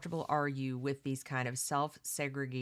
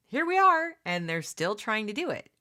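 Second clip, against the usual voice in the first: much higher and louder, exasperated; exasperated outburst; 5 semitones higher, 7 dB louder than usual. Real, not acted. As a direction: burst out in disbelief at the news. Exasperated about ongoing standardization.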